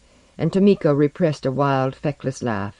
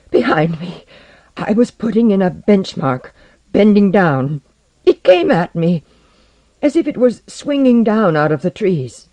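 high, loud, broken into short bursts; terrified, panting report; 6 semitones higher, 7 dB louder than usual. A narrator's reading. Porlock tells base what came at him, terrified. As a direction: blurt it out after fleeing in terror. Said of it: "opaque eyes of terror or exhaustion".

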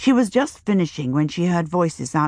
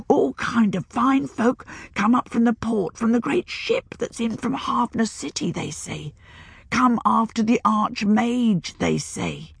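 put-on old woman whispering; 5 semitones higher than usual. A narrator's reading. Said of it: "the old woman whispered dramatically".

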